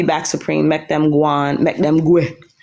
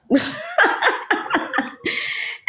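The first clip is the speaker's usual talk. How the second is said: high laugh; 5 dB quieter than usual. Real, not acted. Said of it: "Envy said I was a donut".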